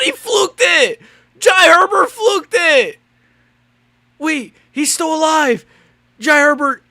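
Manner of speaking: high, loud disbelieving shouts; stunned disbelief. Real, not acted. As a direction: yell in disbelief at the fight. Reacting to a fluke comeback.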